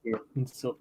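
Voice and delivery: soft, put-on Russian accent; half-held Russian accent. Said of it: "keep the Russian accent going".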